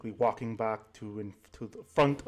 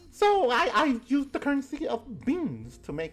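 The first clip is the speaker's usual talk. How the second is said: put-on old granny voice. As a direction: coo like a doting grandma pinching cheeks. Granny about her beans.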